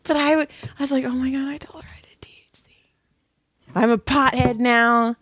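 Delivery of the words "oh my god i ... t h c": whispered, panicked aside. Retold or imitated. Masseuse whispering her panic over the blurted confession. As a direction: hiss the thought to yourself in horror.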